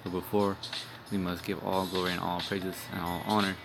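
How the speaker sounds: ordinary delivery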